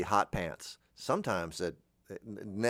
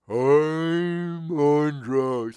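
very slow drawn-out stoned drawl; imitating a comic's slowed-down drug voice; Robin Williams slow talk; 5 semitones higher, 8 dB louder, much slower than usual. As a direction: stretch every word like you're high. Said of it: "He'd talk real slow".

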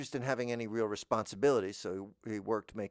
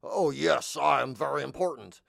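louder, pompous put-on voice; mock self-important; 3 semitones higher, 5 dB louder than usual. Show-off craving love, mock pompous. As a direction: puff yourself up, hammed up. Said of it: "trying to impress you".

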